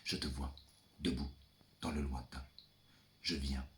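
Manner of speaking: low, soft and hushed, as if to someone far off; soft intimate murmur. Acted. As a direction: murmur it close to the mic. Distant father, hushed address.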